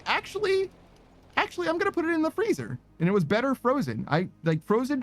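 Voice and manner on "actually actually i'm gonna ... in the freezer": high-pitched self quote. Retold or imitated. Acting out own past thought.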